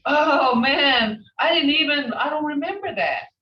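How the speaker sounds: playful student who missed it; mock surprise. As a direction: act the forgetful student, half laughing. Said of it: "They'll say".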